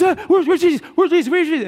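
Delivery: high, loud mock panic with a laugh; hammed-up frantic searching; frantic women. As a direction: play the women hunting for Jesus. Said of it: "So you have this".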